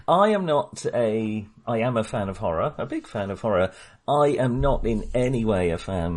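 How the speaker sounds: ordinary talk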